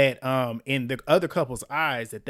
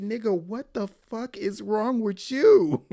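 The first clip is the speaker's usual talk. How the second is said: high, slow, drawn-out disbelief; incredulous outburst; 6 semitones higher, 4 dB louder, slower than usual. Real, not acted. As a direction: stretch it out high, utterly appalled. Outraged reaction to his complaint.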